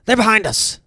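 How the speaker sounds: high, loud panicked yells; panicked screaming. Acted. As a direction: scream the warning across the deck. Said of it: "you hear people screaming".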